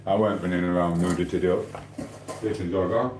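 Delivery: normal talking voice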